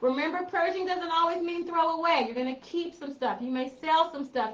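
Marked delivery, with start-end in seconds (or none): none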